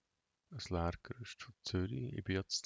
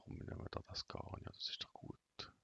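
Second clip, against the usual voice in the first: whispering; 9 dB quieter than usual. Real, not acted. Aside to himself while shopping.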